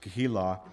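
Keilah, the h sounded in the middle; Hebrew pronunciation. Shown as a demonstration. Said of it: "that's how they say it in Hebrew language".

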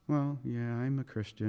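soft, low, sheepish mumble; wimping out. Acted. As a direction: imitate a timid, half-hearted admission. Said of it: "you kind of wimp out like".

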